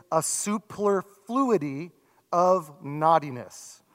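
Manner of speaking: "a superfluity of naughtiness" drawn out slowly, each word weighed; slow savoring. Shown as a demonstration. Pastor reading the King James phrase slowly to the congregation. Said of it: "I have no idea what that means".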